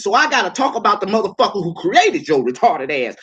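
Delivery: ordinary delivery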